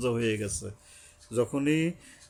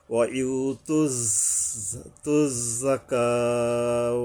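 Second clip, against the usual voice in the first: very slow তুজ জাকাও with held sounds; drawn-out recitation; much slower than usual. Shown as a demonstration. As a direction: linger on the z, then stretch জাকাও. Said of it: "জাকাও, এক আলিফ টানতে হবে". (তুজ জাকাও ধীরে টেনে)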